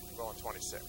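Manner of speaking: a drained runner who can barely talk; weary marathoner. Acted. Weary marathoner answering, low voice.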